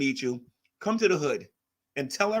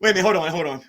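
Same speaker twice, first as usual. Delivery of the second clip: ordinary talk, nothing marked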